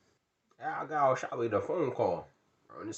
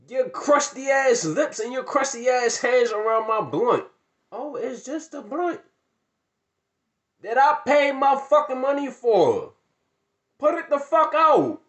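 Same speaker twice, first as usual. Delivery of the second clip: higher, louder angry rant; furious; 9 semitones higher, 9 dB louder than usual. Retold or imitated. Ranting at the girls who took his blunt, furious. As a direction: tear into them.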